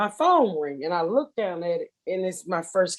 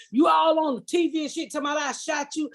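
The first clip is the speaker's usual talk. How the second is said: imitating ex shouting; 7 semitones higher than usual. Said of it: "he gonna tell myself".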